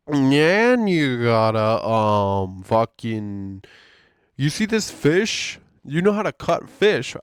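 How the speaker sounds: loud, slow, dismissive mock boss. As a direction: boom it out like a bossy supervisor. Mocking boss brushing him off.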